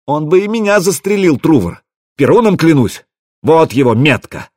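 high-pitched, aggrieved protest; hysterical outcry. A narrator's reading. Rasmus swears to Truvor he was shot at, hysterical. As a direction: plead your grievance in a high, hysterical voice. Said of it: "кричит истерично, как обиженная баба".

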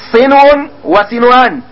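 صنو وصنوان said slowly, each word drawn out; slow model reading. Shown as a demonstration. Teacher to pupils modelling the word pair, slow and careful. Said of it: "صنو، صنو".

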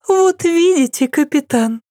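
high, light smiling princess voice; serene smile. A narrator's reading. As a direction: say it sweetly, untroubled. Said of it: "безмятежно улыбнулась она". (безмятежно, с улыбкой)

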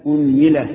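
أنملة as "unmila", u first, i on the meem; closing list item, أنملة as unmila; u then i. Shown as a demonstration. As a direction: say أنملة loudly as UN-mi-la, falling. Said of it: "ضم الهمزة".

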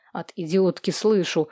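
usual speaking voice

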